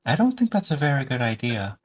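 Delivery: put-on high, uneasy young voice; worried boy. Son tells stranger his plan is bad, high and worried.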